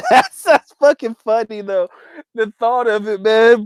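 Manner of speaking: high, laughing through the words; can't hold back the laugh. Real, not acted. Laughing at the Vaseline story with chat.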